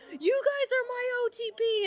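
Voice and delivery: retold crowd scream. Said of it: "somebody in the crowd just screamed".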